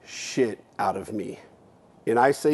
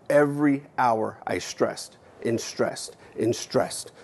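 normal talking voice

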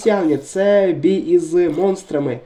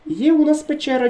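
high, delighted cry of discovery; excited gamer shout of discovery; excited find; 8 semitones higher than usual. Real, not acted. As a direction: pitch jumps up on finding the cave. Finds the cave.

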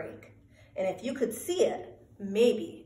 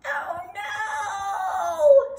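high, loud drawn-out wail; hammed-up cry of dismay; steamed wail; 13 semitones higher, 5 dB louder than usual. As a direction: wail it high and long as you wash away. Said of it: "steamed the germ".

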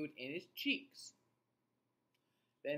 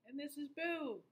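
squeaky puppet voice; 12 semitones higher than usual. Acted. Introducing puppet character.

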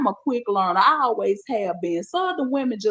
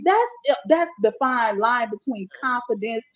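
usual speaking voice

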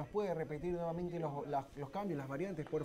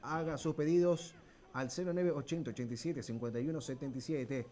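normal talking voice

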